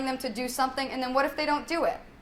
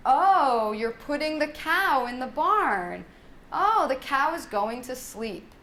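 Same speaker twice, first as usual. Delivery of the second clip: slow commentary with a big drawn-out "Oh"; play-by-play for a child; 3 semitones higher, slower than usual. Shown as a demonstration. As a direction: narrate a child's play with stretched, lilting words. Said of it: "a sportscaster".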